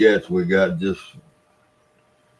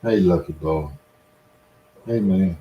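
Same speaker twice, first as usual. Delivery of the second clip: softer, lower coaxing to a cat; talking to a pet; 4 semitones lower, 5 dB quieter than usual. Real, not acted. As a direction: gently coax the cat, low and soft.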